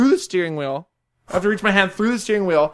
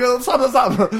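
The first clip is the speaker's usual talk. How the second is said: raised-pitch pleading; mock panic; 7 semitones higher than usual. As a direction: beg the car to stop, half laughing. Pleading with the lurching car.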